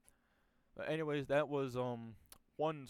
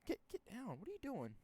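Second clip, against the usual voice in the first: high and softer, shooing a pet; telling the dog off in a high, softer voice; 10 semitones higher, 6 dB quieter than usual. Real, not acted. Speaker scolds dog jumping up.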